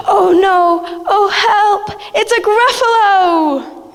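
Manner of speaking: high, gasping panic; panicked cry. Acted. Mouse meets the real Gruffalo, high panicked cries. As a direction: gasp and cry out in fright.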